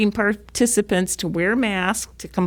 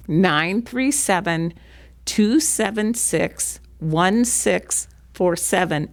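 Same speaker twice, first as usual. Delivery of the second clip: slowly, digit by digit; slower than usual. Real, not acted. Giving viewers the number to call, slowly. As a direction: read the number out slowly, grouped with pauses. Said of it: "I'll say it slowly".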